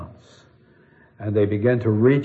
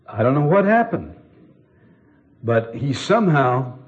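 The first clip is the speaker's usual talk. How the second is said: awed officers; 6 semitones higher, 4 dB louder than usual. Retold or imitated. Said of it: "and the men said".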